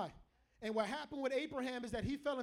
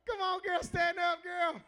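higher-pitched, urging call to his wife; playful calling out; 5 semitones higher, 4 dB louder, slower than usual. Real, not acted. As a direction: coax her up out of her seat. Coaxing wife to stand up.

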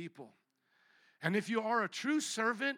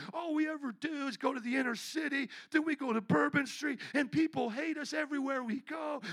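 high-pitched, acted-out complaining; self-pitying rant; 9 semitones higher, 4 dB louder than usual. Student complaining in the car about Bible college, self-pitying rant. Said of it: "I'm going off like that".

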